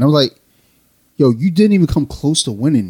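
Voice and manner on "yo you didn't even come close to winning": loud, higher-pitched disbelief; mock protest. Bowler answering his companion's claim to have won, loudly incredulous. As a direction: push back hard on a false boast, retold. Retold argument over who won.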